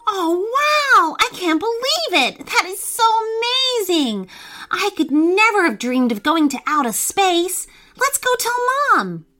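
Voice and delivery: put-on high, thrilled child's voice; excited. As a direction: squeal with amazement. Child hears of space trip.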